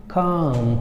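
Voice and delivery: काम with long aa and no l; teacher demo of calm; silent l. Shown as a demonstration. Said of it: "कैसे देखें?".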